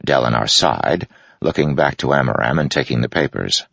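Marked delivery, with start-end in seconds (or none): none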